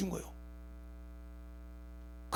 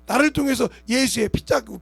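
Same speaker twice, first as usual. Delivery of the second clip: usual speaking voice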